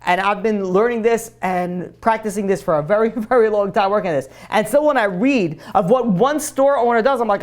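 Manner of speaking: ordinary talk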